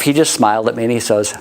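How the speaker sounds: usual speaking voice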